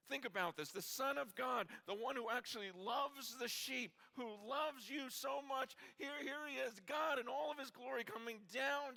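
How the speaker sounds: high, loud, impassioned sermon voice, near shouting; preaching at full force. Acted. Preacher stirring the congregation over Jesus in the garden, impassioned shouting. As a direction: pour it out like the Gethsemane scene is happening now.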